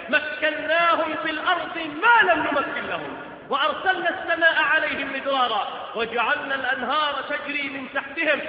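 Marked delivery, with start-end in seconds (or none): none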